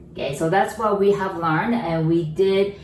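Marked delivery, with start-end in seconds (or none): none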